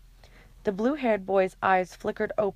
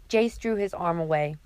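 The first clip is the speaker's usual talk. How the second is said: usual speaking voice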